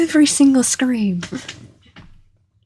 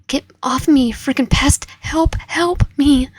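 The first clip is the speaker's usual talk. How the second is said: panicked yelling; 4 semitones higher, 6 dB louder than usual. Acted. Victim fights off kidnapper.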